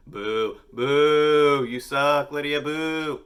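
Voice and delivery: loud, high drawn-out booing; mock heckling. Host publicly shaming a fan, mock heckling. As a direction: heckle like a playful crowd.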